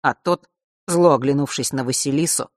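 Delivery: plain reading voice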